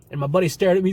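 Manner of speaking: usual speaking voice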